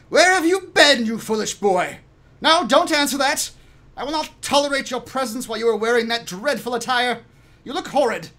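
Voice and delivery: high, loud scolding woman; high-pitched, forceful telling-off; woman yelling. Acted. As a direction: berate the boy as his stern mother. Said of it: "the sound of her yelling at the boy".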